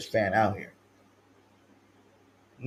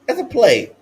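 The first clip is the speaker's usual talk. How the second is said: higher, insistent repeat; pitched-up emphatic defense; emphatic insistence; 9 semitones higher than usual. Real, not acted. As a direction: hammer the point home. Insisting on the same words.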